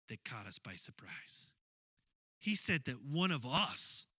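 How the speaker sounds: ordinary delivery, nothing marked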